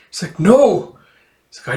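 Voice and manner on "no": high, loud cry of denial; a sharp refusing cry; anguished no. Acted. Lucy denying her father is damned, anguished.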